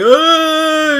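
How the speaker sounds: high-pitched imitated sobbing wail; mock crying wail. Imitating a friend crying on the phone, mock wail. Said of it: "and then you hear crying".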